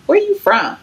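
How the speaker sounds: high, bright friendly question; chirpy getting-to-know-you; perky. Retold or imitated.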